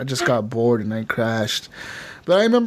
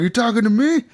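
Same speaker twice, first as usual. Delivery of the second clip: higher mock-indignant question; mock challenge; 9 semitones higher than usual. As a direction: play at squaring up to someone. Acted bar quarrel.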